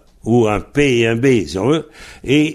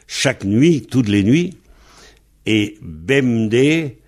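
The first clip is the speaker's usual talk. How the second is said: usual speaking voice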